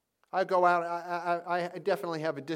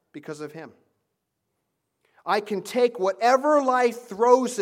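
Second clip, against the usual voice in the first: confident declaration; 2 semitones higher, 6 dB louder than usual. Shown as a demonstration. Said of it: "say this to ourselves every day".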